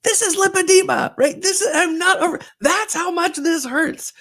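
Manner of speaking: high, loud and near tears, slowing at the end; pained plea. Pretend. Mom wishing to tell daughter her pain is real, tearful. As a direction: plead through tears to be believed. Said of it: "And I wanted to go".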